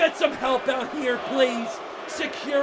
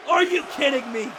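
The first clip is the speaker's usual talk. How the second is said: ordinary talk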